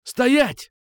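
sharp, very high and loud bark; barked command. Acted. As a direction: bark the order to freeze. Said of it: "рявкнул я".

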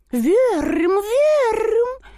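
very high pigeon coo. A narrator's reading. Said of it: "сказали голуби".

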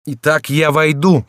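high, slow, determined cry. A narrator's reading. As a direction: declare it loudly, word by word. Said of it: "вскричал Генрих".

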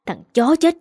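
a father's enraged curse; furious curse. Acted. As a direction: spit out the curse in rage.

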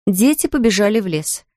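ordinary delivery, nothing marked

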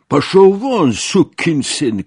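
high, loud furious yell; hateful shout. A narrator's reading. Narrator cursing the soldier in their way, hateful shout. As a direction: yell the curse to drive him off. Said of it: "krzyknąłem, patrząc z nienawiścią".

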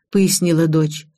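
usual speaking voice

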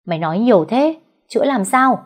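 high, loud panicked retort; a frantic mother cutting the healer off; sharp and impatient, rising at the end; alarmed snapping. A narrator's reading. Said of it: "hoảng hốt gắt lên".

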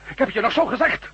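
loud, high, exasperated scolding; angry scolding. Acted. Man scolds the sheriff for attacking like a bull, angry and loud. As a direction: berate him for rushing in.

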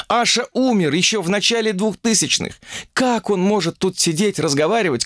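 put-on high-pitched, exasperated young woman; indignant young woman. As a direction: rant at their gullibility in a high voice. (возмущённая девушка, высоко и запальчиво)

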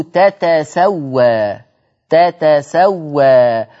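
"تتسوى" slowly as ta-ta-sa-wa; تاءان منفصلتان. Shown as a demonstration. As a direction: separate both ta sounds in "تتسوى", drawing it out. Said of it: "باتنين تاء". ("تتسوى" بتاءين مفتوحتين، ببطء)

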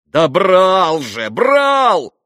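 slow, loud cry rising to a shout; exclaiming. A narrator's reading. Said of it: "воскликнул Митя". (громкое отчаянное восклицание)

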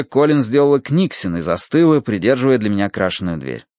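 ordinary delivery, nothing marked

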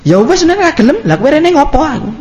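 high-pitched exasperated retort. Retold or imitated. Preacher replaying his answer to a stubborn man, high and exasperated. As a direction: throw up your hands and snap back. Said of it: "nggak mau dia, Pak Ustadz".

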